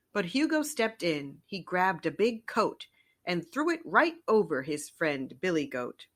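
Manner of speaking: usual speaking voice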